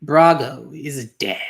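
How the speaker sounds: slow, loud, heavy pronouncement; slow, menacing villain's reveal; ominous reveal. Acted. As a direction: drop the news like a hammer.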